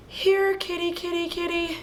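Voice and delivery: high sing-song cat call; calling a kitty. Retold or imitated.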